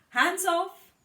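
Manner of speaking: loud, high hands off; sharp warning. Acted. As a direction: snap at paint-smeared kids. Mother warns messy kids.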